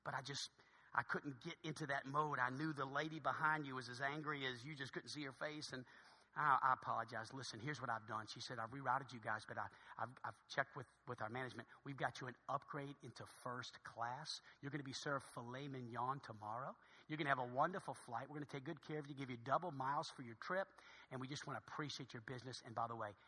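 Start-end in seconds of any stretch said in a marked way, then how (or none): 0.0-8.3 low, very soft voice; confiding apology. Acted. Gate agent apologizing to the kind passenger, hushed confiding. 8.6-23.1 low, very soft confiding; hushed, grateful. Acted. Gate agent quietly rewarding the nice couple, hushed and grateful.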